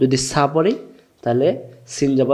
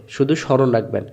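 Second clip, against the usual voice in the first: usual speaking voice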